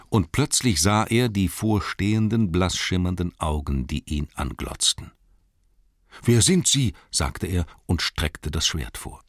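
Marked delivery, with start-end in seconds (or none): 6.2-6.9 put-on high, alarmed young voice; startled hobbit. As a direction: blurt it out in alarm. Startled hobbit challenges creature.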